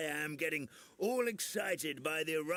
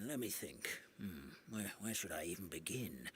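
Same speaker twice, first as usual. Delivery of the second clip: muttering to himself; 7 semitones lower, 7 dB quieter than usual. Acted. Elder thinking aloud.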